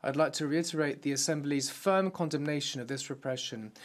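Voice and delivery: normal talking voice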